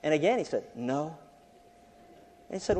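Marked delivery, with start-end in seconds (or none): 0.8-1.2 put-on soft, hushed child's reply; whispered little boy. Little boy refusing the boss on the phone, hushed. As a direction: imitate a boy keeping it secret. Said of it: "in a whispered tone".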